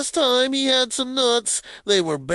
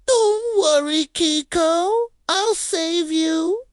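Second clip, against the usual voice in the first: heroic shout; 9 semitones higher, 3 dB louder, slower than usual. Acted. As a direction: call out high and bold. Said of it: "Grumble shouts".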